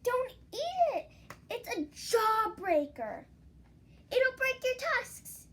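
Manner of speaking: high, slow, loud squeaky mouse. Acted. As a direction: squeak out the warning in panic. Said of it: "squeaked Mister Mouse".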